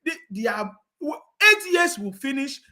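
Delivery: ordinary talk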